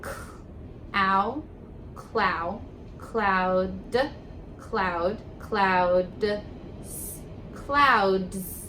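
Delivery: K-, ow, clow, cloud sounded out slowly piece by piece; blending sounds. Shown as a demonstration. Teacher blending the word cloud aloud for young readers. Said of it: "Let's blend it".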